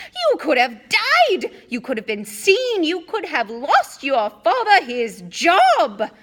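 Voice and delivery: high, loud, slow furious shouts; angry shouting. A narrator's reading. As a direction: shout each charge at him. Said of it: "shouted herself hoarse".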